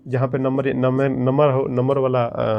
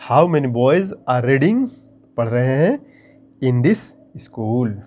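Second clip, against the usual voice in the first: very slow, word by word; slow dictation; much slower than usual. Shown as a demonstration. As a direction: spell out the answer sentence. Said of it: "model sentence".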